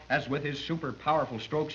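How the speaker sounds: normal talking voice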